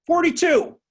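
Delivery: spring the count on him; pointed reveal. Retold or imitated. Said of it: "kept tally marks".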